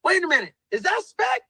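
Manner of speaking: high, surprised double take; voicing Drake surprised to see Spec; surprised recognition. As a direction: pitch it up as he spots his friend. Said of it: "he was like".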